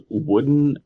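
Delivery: normal talking voice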